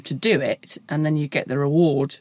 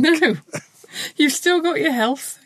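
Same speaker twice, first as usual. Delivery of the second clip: grinning silver-lining cheer; annoyingly upbeat; 9 semitones higher than usual. Pretend.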